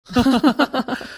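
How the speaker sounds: a brief amused laugh; short chuckle. Acted. The groom's friend chuckling at the teasing, short laugh. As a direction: chuckle it off lightly.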